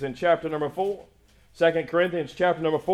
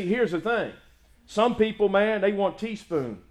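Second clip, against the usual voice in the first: ordinary talk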